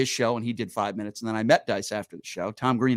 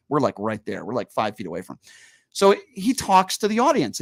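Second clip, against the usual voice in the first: ordinary talk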